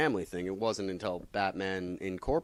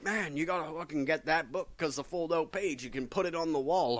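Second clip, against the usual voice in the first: friends hyping the book; 7 semitones higher than usual. Retold or imitated. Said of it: "all my friends are like".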